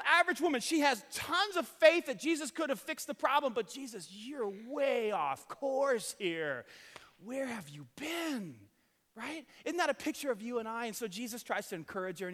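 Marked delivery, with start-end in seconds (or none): 3.7-8.6 slow, drawn-out reproach; mock reproach. Pastor voicing Martha reproaching a late Jesus, slow mock reproach. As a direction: drag it out like a disappointed sister.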